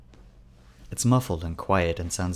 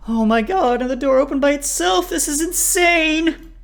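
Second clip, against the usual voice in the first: very high, loud hysterical cry; hysterical, high-pitched freak-out; panicked wail; 10 dB louder than usual. A narrator's reading. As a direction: shriek in terror, drawing out the last word. Tied-up Raven panics at opening door.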